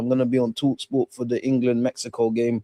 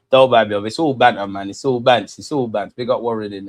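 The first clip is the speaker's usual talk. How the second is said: usual speaking voice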